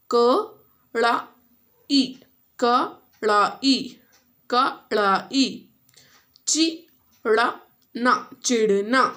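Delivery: कड़ाई and चिढ़ना said very slowly, twice each; teacher modelling slow reading; sounding out. Shown as a demonstration. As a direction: stretch each syllable for the class. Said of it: "you have to read and then you have to repeat".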